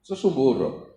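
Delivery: low, quiet mocking put-down; scornful teacher. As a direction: sneer it like a teacher belittling a pupil. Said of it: "de un profesor que te dice".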